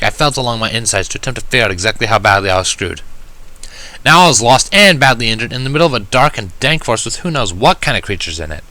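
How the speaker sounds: ordinary delivery, nothing marked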